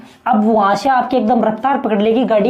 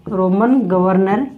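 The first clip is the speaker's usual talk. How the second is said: Roman governor drawn out slowly and lower; slow dictation; 4 semitones lower, much slower than usual. Shown as a demonstration. Teacher dictating to students for their notes, slow and lower. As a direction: spell out Roman governor word by word, slow, for writing. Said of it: "teacher repeats term for notes".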